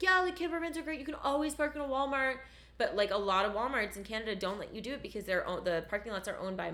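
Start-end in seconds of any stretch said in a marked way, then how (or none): 0.0-2.4 high, bubbly mock of everyone's advice; chirpy know-it-all advice. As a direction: parrot the cheerful camper-van crowd, a bit higher. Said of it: "everybody's like".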